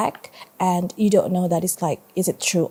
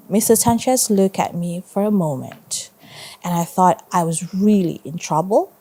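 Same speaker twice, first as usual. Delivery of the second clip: usual speaking voice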